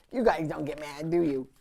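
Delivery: ordinary delivery, nothing marked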